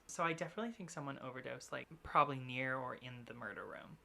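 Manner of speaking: normal talking voice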